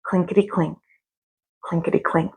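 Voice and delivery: soft, hushed coin rattle; faint clinking. A narrator's reading. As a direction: let it drop away to almost nothing. Faint coin sound in the dark.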